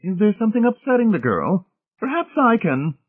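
high-pitched anxious matron. A narrator's reading. Mrs Pritchett inquiring around the table, anxious. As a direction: ask with fussy, high-voiced worry. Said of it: "inquired anxiously, gazing around the table".

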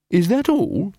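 high, dismissive question; Angua unimpressed. A narrator's reading. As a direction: shrug it off, up high.

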